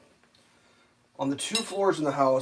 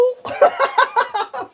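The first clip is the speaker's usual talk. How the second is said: high laugh; 8 dB louder than usual. Real, not acted. Laughing at his own mockery.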